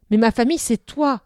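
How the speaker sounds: loud, firm retort; same firm tone. A narrator's reading. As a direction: throw it back at him firmly. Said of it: "répliqua Eneda du même ton". (réplique forte et ferme)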